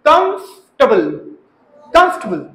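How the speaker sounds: कंफर्टेबल as "KUMF-tuh-bul"; reduced comfortable pronunciation. Shown as a demonstration. Teacher drilling students, कंफर्टेबल as "KUMF-tuh-bul". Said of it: "कंफ नहीं करना".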